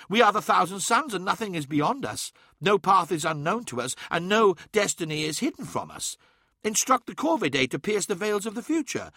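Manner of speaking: put-on young high voice, proud and defiant; rally your brother to fight; defiant Ammon. A narrator's reading. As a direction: Ammon arguing for war.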